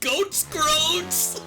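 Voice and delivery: silly yell. Acted. Silly shouted insult.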